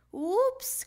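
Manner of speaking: high, loud sudden whoops; startled cry. Acted. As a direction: blurt it out in surprise. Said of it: "cried Froggy".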